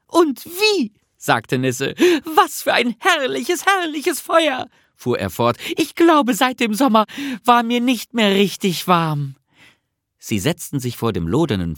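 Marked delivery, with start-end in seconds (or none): 0.1-0.9 very high little-creature exclamation; enthusiastic agreement. A narrator's reading. Nisse agrees with Bertil about the fire, enthusiastic. 2.4-4.7 very high, rapturous creature voice; delighted. A narrator's reading. Nisse admires the splendid fire, delighted. 5.8-9.4 high-pitched, happy creature voice; delighted little Nisse. Acted. Nisse enjoys first warmth since summer, delighted.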